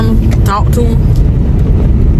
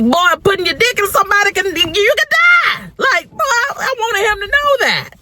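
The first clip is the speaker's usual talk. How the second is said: high, strained voice on the edge of crying; pleading with the son, voice cracking; emotional outburst; 12 semitones higher than usual. Real, not acted. Mother warning her teenage son, voice breaking into tears.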